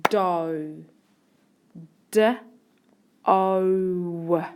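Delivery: "Do" as doh, then "D" and "O" apart; sounding out. Shown as a demonstration. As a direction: break "Do" into short "D" and long, held "O". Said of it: "Listen to the second syllable".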